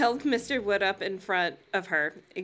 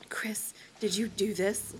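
slow, near-whispered question; asking softly. A narrator's reading. Lindy questions her sister, soft slow whisper. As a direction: breathe it out slowly, almost without voice. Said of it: "Lindy asked softly".

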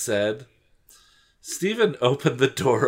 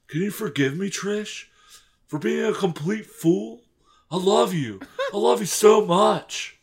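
high, overwrought pleading; over-earnest lovesick boy; melodramatic apology; 7 semitones higher than usual. Acted. Melodramatic apology of story's lover.

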